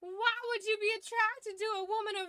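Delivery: hammed-up Southern Belle protest. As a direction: play her as a high-voiced Southern Belle. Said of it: "I made her a Southern Belle".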